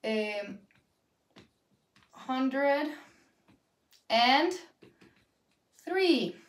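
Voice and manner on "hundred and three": hundred... and... three, each word on its own. Shown as a demonstration. Teacher dictating a number to students, word by word. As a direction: dictate each word separately with long pauses.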